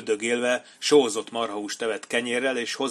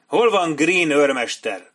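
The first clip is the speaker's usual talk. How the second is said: loud, slow, commanding call; 5 semitones higher, 9 dB louder, slower than usual. Acted. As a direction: summon a sergeant from the crowd. Calls out for the sergeant. (hangos, parancsoló kiáltás)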